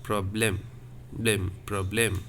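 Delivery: "Problème", then "blème" alone, then the whole word; low, deliberate "blème, problème" with short open è. Shown as a demonstration. Teacher breaks down "Problème" for students, E kept short. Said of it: "it is a very short E".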